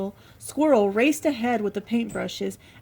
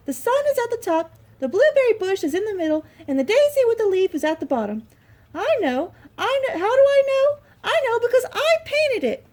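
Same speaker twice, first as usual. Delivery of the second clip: very high, loud mouse, quick in places; proud little mouse explains; squeaky mouse voice; 11 semitones higher, 6 dB louder than usual. Acted. Little Mouse's long speech.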